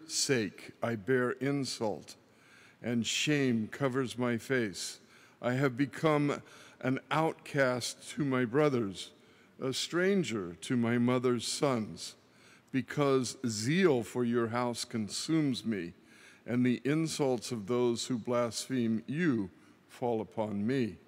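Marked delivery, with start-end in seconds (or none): none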